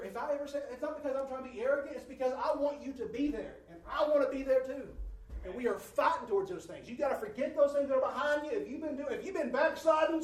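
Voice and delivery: urgent sermon build, loud and high; preaching. Acted. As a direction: drive it home to the congregation. Preaching at full force.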